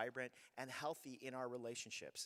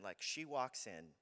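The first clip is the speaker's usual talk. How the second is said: usual speaking voice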